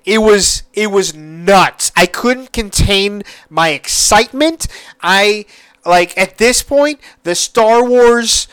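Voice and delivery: ordinary delivery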